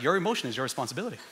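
put-on fast, soft singsong answer to a bored kid; dad rattling off his line to a bored kid, fast; rattled-off quote. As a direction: toss it off quick and quiet. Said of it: "I'm like".

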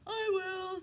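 keen farm cow; eager cow. Acted. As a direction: perk up like a waking cow. Cow voiced in story.

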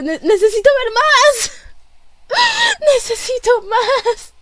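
high, tearful pleading excitement; starting to cry. Real, not acted. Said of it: "Ya, ya estoy empezando a llorar". (súplica aguda, al borde del llanto)

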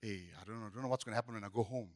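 very low, hushed tired mumble; mock weary. Churchgoers after the meeting heading home, mock tired and downcast. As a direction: play drained, voice dropped right down. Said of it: "our conversation changes".

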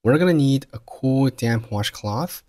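"cool", "damp", "washcloth" stressed and drawn out, "washcloth" rising; stressed, slowed key words. Shown as a demonstration. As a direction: rush lightly over "we're gonna", then lean slowly on "cool", "damp", "washcloth". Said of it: "都做重读".

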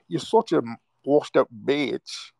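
high, drawn-out mocking European accent. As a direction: mimic his insult in a prissy European accent. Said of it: "one of them moist European accents".

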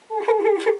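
high-pitched cackle copying a friend; imitated crying laugh. Sticks cracks up in the background, high crying laugh. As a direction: mimic him helpless with high laughter. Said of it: "like, Sticks has this laugh and he's, like, crying".

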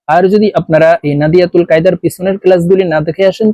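normal talking voice

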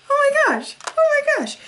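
high excited squeal; real excited squeal. Real, not acted. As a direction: squeal with delight, loud and high.

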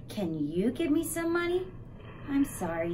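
ordinary delivery

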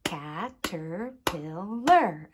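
"Caterpillar" in four slow, even beats; clapped syllables. Shown as a demonstration. Teacher clapping with children, "Caterpillar" split into syllables. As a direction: clap out "Caterpillar" as ca, ter, pil, lar. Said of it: "Let's clap it".

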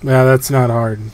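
ordinary talk

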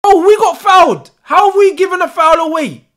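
high, loud disbelieving complaint; indignant shout. Real, not acted. Fan whose team just got fouled, indignant shout. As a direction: cry out at an unjust call.

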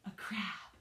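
ordinary talk

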